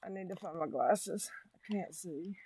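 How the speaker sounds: hushed unvoiced whisper to herself. Real, not acted. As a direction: whisper it while searching for glasses. Fumbling for glasses, speaking privately.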